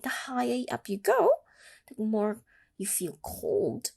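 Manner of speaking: ordinary delivery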